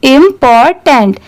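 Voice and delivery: "important" slowly, syllable by syllable, im-por-tant; very slow teaching read; slow blended word. Shown as a demonstration. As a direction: stretch "important" out while joining the parts. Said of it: "इसे speed में पढ़ेंगे".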